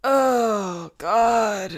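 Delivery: put-on drawn-out groan of an old chain-smoker; old smoker man. Player opening a flamingo card in an accent, old smoker man voice. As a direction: put on an old man with too many cigs. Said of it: "This is the old man who's had too many cigs accent".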